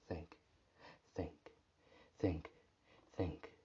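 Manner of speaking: soft, crying mutter; tearful mutter. A narrator's reading. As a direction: whimper each word under your breath. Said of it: "till it turns into a mutter".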